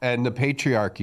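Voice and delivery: ordinary talk, nothing marked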